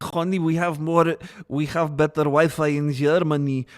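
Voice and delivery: amateur mock German accent. Streamer imitating a viewer bragging about Wi-Fi, German accent. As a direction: put on a clumsy German accent. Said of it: "I don't know how to do a German accent".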